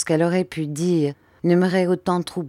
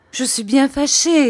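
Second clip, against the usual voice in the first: high, louder apology; upset wife; 9 semitones higher, 5 dB louder than usual. A narrator's reading. Mother excusing herself to her husband, high and upset. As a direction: plead with him in a raised pitch.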